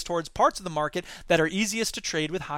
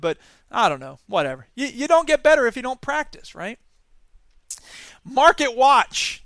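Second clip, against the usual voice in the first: ordinary talk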